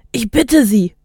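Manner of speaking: acted urgent appeal; urgent plea called out. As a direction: appeal called over a crowd. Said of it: "rief Ogilvy".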